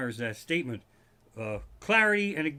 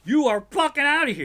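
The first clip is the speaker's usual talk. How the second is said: umpire yelling; 11 semitones higher, 9 dB louder than usual. Retold or imitated. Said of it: "And the fucking umpire goes".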